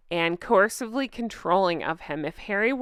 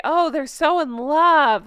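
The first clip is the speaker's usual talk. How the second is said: mocking gush; 6 semitones higher, 5 dB louder, slower than usual. Mocking fans' gushing quote.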